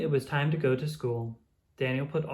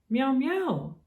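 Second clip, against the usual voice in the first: high, playful kitten meows; kitty meow; 11 semitones higher than usual. Acted. Katerina greeting Daniel, high playful meows. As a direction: meow up high like a little cat.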